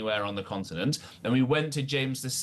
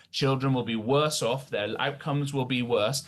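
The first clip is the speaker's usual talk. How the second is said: ordinary talk, nothing marked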